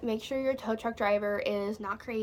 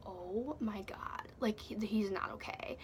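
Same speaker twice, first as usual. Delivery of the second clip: whispered, slow disbelief; horrified hush; 8 dB quieter, slower than usual. Retold or imitated. Reacting to so much blood, horrified whisper. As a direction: drop to a stunned whisper.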